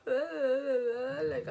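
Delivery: loud, high hammed-up sob on like; mock crying wail. Vlogger reliving losing her boyfriend, loud mock wail. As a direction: wail it like a dramatic teen. Cry heard on line.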